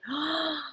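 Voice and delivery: quick breathy gasp of shock; sharp gasp. Real, not acted. Reacting to news of Gene Hackman's death, a soft shocked gasp. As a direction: catch your breath at sad news.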